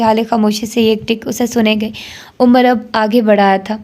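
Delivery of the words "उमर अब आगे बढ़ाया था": ordinary delivery, nothing marked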